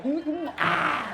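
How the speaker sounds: high, drawn-out mm and ah; acted kissing noises. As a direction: hum a comic kiss, ending in a long ah. Acting out the forehead kiss. (suara ciuman dibuat-buat)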